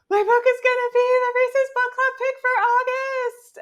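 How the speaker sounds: very high, loud squeal of news; retold excited screaming. Author telling partner the big news, excited screaming. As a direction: shriek the whole announcement at full pitch. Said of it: "she'd heard me screaming".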